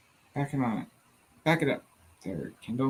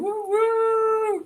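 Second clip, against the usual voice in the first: rising high, drawn-out woo; celebratory cheer. Real, not acted. Host cheering a viewer's finished assignment, celebratory cheer. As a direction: build up into a high whooping cheer.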